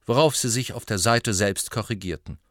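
usual speaking voice

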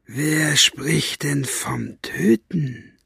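slow, silky purring menace. Acted. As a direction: purr it like a cat toying with prey. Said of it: "schnurrte Buster".

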